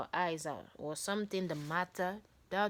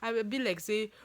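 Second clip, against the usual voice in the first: ordinary delivery, nothing marked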